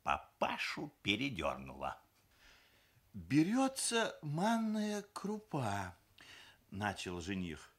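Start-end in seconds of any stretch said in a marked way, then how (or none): 3.1-5.9 high, slow, careful recitation; examinee groom. A narrator's reading. Groom answering mother-in-law's cooking question, carefully. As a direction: recite it like a pupil at an exam.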